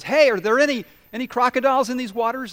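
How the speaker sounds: high, loud call across the water. Acted. Fisherman clinging to his boat calling to a man on shore.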